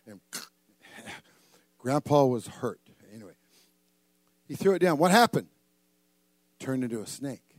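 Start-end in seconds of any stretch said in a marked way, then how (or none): none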